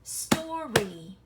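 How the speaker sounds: "Story" loud, in two separate beats; clapped-out syllables. Shown as a demonstration. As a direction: clap out "Story" as stor, ree. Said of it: "let's clap it out".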